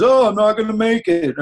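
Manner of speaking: high, loud put-on moan of someone bailing; hammed-up whine about skipping training; mock whiny excuse. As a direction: imitate a flaky friend backing out. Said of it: "that person says".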